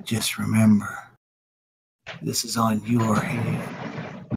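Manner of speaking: low, soft menacing threat; menacing villain. Acted. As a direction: threaten him quietly and slowly. Villain threatens magistrate quietly.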